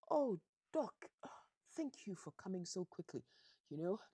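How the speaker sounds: high put-on patient voice; feigned invalid, loud high opening; posing as Donati. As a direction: pretend to be the old man greeting his doctor. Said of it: "as though he is Donati".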